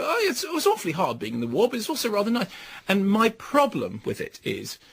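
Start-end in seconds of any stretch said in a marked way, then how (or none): 0.0-2.5 lightly voiced, higher plummy sigh of wartime nostalgia; mock-genteel, a touch quicker; wistful posh English. Critic parodying the film's cosy wartime tone, lightly plummy. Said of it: "picturesque in a sort of".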